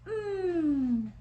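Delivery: "Mm" hummed with closed lips; M sound. Shown as a demonstration. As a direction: hum "Mm" with lips together. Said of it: "See how I close my lips?".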